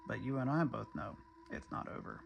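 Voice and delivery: low, hushed confiding tone; ominous aside. Real, not acted. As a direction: drop low and soft, as if sharing a grim secret.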